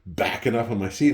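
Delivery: ordinary delivery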